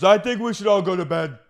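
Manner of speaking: high, loud order; mock exasperated yell. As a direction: shout it as an impression of someone fed up. Acting out Dorinda ending argument.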